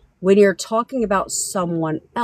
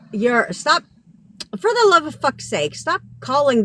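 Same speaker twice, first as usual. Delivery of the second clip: exasperated; 9 semitones higher than usual. Real, not acted. Exasperated scolding of caller.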